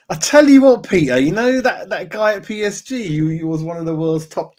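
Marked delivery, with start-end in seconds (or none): 0.1-2.6 excited burst. Real, not acted. Sudden excited idea.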